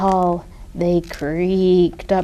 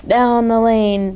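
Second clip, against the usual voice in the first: ordinary delivery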